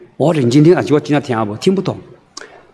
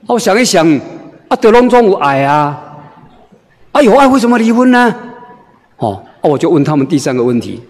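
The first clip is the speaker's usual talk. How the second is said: usual speaking voice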